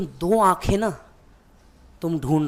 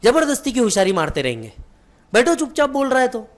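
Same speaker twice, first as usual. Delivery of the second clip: higher and louder, a mother's exasperated telling-off; scolding; 7 semitones higher, 5 dB louder than usual. Retold or imitated. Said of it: "mummy ने पप्पा को डांटा". (Mummy का झुंझलाकर डांटना)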